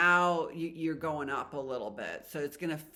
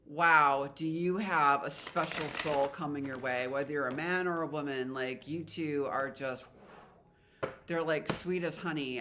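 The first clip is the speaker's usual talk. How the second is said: ordinary delivery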